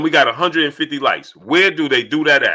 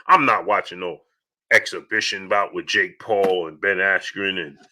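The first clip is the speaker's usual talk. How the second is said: very low, imitated naysayer drone; put-on low voice of a refuser; mock refusal; 10 semitones lower, 4 dB quieter than usual. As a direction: sink right down to mimic dismissive fans. Resumes the mimicked refusal after greetings.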